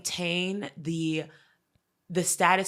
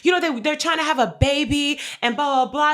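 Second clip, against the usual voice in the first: mock gushing; 7 semitones higher, 5 dB louder than usual. Retells mom gushing about ex-friend.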